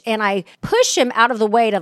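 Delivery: normal talking voice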